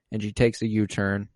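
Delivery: usual speaking voice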